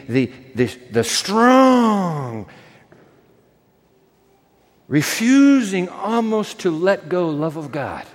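ordinary talk

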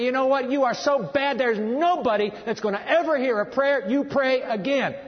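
acted scornful outburst; condemning universe. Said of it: "half of the universe would say".

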